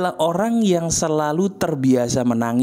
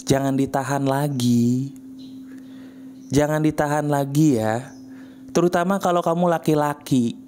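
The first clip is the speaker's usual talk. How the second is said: ordinary talk, nothing marked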